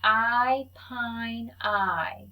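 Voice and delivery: each sound said slow and clear for the class to copy; teacher modelling A for repeat; vowel demo. Shown as a demonstration. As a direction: model the long vowel for echo. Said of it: "I'll say it, then you say it".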